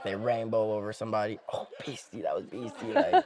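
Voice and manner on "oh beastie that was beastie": hushed, soft amazement with a laugh at the end; awed reaction. Acted. Fan seeing a crazy soccer move, hushed awe. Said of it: "like, a crazy soccer move".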